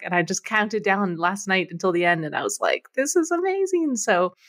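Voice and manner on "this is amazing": excited quote. Retold or imitated. Retold reaction at campaign end.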